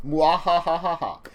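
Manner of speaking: slow villainous mwahahaha; evil laugh. Pretend. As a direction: cackle like a cartoon villain. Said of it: "a very D M thing to do".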